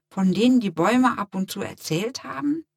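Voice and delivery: plain reading voice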